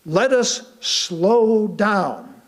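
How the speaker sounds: very slow, drawn out, each word given weight; slowed right down. Acted. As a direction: let the words themselves slow down. Preacher urging to slow down.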